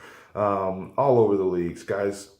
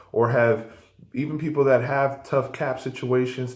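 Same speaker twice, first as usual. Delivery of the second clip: normal talking voice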